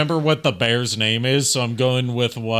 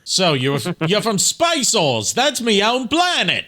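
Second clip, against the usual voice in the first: hammed-up Aussie twang, pitched up; Australian accent; 7 semitones higher than usual. Mimics an Australian space character.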